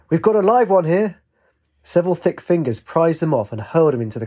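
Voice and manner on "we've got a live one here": high-pitched excited call across a crowd. A narrator's reading. Ajax calls out while clinging to a bucking man, excited holler. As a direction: shout it out like a fairground holler. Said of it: "Ajax called".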